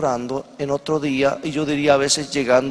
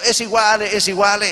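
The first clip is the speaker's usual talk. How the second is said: higher-pitched mock Italian accent; 6 semitones higher than usual. Italian standing on the seat answers complainers, Italian accent. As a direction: brush it off in a put-on Italian accent. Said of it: "en su mezcla de italiano".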